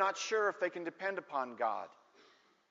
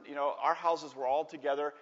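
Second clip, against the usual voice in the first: normal talking voice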